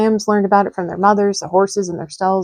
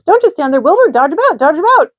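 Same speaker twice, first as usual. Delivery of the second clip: very high, loud honking goose cries; a goose barking orders to the pig; shrill-pitched urgent goose shouts; excited goose; 9 semitones higher, 7 dB louder than usual. A narrator's reading. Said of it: "cried the goose".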